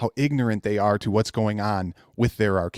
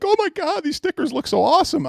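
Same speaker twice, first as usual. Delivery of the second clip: very high, excited mock gushing; gushing fanboy. Mocking fans gushing over stickers, very high squeal. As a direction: squeal like a starstruck fan. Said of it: "everybody's like".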